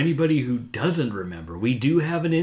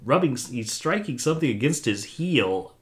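ordinary talk, nothing marked

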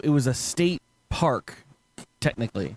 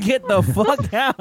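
raised-voice, slow mock eviction order; exaggerated higher-pitched order to leave; 9 semitones higher, 4 dB louder, much slower than usual. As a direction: boom it slowly like an officer clearing people out, half laughing. Acting rangers kicking the party out.

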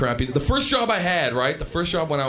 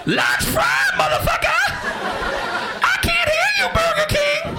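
screaming rocker customer. Retold or imitated. Skid Row customer screaming order at drive-thru, rock-star yell. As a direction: scream like a metal frontman at a crowd.

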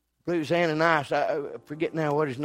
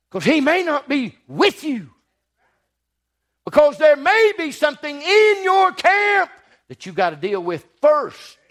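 higher, louder preaching climax; fiery preaching; 11 semitones higher, 9 dB louder than usual. Real, not acted. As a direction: build to a shouted warning to the church. Preacher rises to full force.

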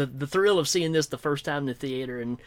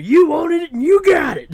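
loud, high mock-angry holler; mad kid; 12 semitones higher, 11 dB louder than usual. Imitating his mad childhood buddy, high angry yell. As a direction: holler the slogan like a furious child. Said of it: "every time he got mad".

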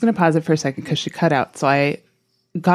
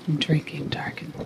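whisper; 8 dB quieter than usual. Acted. Joining spooky whisper.

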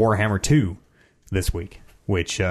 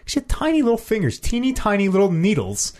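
amazed, high-pitched; 9 semitones higher than usual. Real, not acted. Marveling at tiny fingers.

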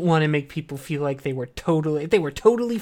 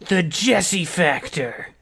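slow announcer voice; much slower than usual. Acted. Announcing a name dramatically.

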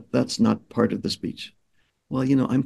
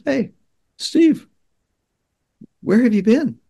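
mom's call, much higher and louder; imitating mom calling; 12 semitones higher, 4 dB louder than usual. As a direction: call out to him like a worried mom. Mom calling to son on beach.

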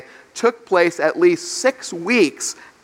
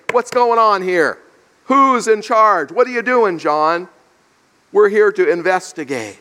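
raised-pitch, forceful interrogation; mock-official challenge, pitched up; officious demand; 3 semitones higher, 3 dB louder than usual. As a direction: act out investigators barging in with questions. Acting the delegation's challenge to John.

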